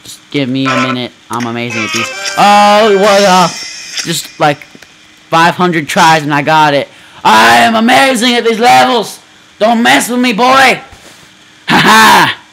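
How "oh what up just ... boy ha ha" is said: loud, much higher triumphant bragging; gloating cheer. Real, not acted. Gamer bragging after beating the level, loud. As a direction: crow over the win.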